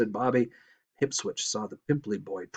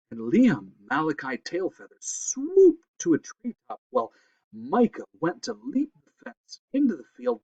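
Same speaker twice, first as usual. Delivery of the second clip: ordinary delivery, nothing marked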